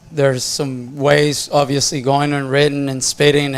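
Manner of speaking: ordinary talk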